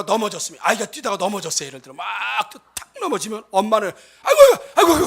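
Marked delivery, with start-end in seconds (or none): 4.2-5.1 high, loud alarmed aigo cries; mother's alarmed cry. Retold or imitated. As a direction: cry out like a mother seeing her child fall. Mother cries out at fallen child.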